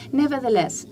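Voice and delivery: ordinary delivery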